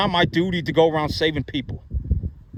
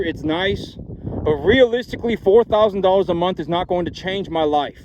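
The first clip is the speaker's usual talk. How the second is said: ordinary talk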